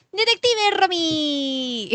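drawn-out, high, loud proclamation; grand slow presentation of a detective; announcer voice. Pretend. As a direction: announce her name like a title card. Announcing her new outfit.

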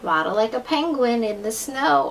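ordinary delivery, nothing marked

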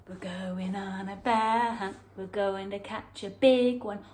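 usual speaking voice

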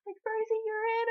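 high and loud, bursting with joy; excited squeal. Retold or imitated. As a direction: shriek the good news to your friend. Friend squeals through window.

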